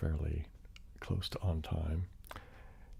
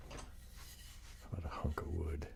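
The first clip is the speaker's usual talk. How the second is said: ASMR whisper; 8 dB quieter than usual. Real, not acted. Whispering to stream viewers before tapping. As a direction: hush it like an ASMR intro.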